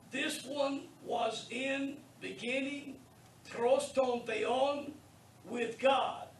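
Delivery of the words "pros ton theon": "pros ton theon" read slowly, syllable by syllable, as Greek; careful Greek reading. Shown as a demonstration. Teacher reading Greek slowly aloud to students. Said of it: "that's how it's pronounced".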